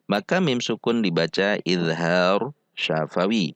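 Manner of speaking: ordinary talk, nothing marked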